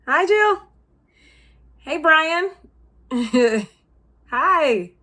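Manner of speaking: high, loud hellos; bright greeting. Acted. Coworker greeting colleagues on a call, high and loud. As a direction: call out cheerily to each coworker.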